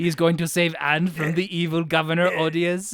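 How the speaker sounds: put-on comic Romanian-accented storyteller; Adrian Tofay. Imagining the actor telling the bandit story, in a mock Romanian accent. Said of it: "If it's Adrian Tofay".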